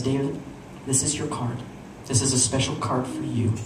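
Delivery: hushed, weighty magician voice; dramatic magician. Retold or imitated. Magician revealing the card to viewer, hushed and dramatic. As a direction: softly reveal it with drama and pauses. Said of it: "you don't need to be so dramatic".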